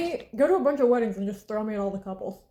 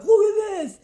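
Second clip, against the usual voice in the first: much higher, louder, delighted "look at this"; excited dad; 11 semitones higher, 8 dB louder than usual. Retold or imitated. Dad showing his daughter an AI video of her mom, high and delighted. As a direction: show it off, thrilled.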